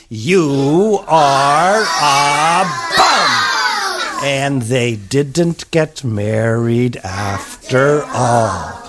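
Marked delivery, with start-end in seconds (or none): 0.1-4.2 very slow drawn-out shout; slow emphatic put-down. Acted. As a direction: spell it out in slow contempt. Elizabeth telling off Ronald.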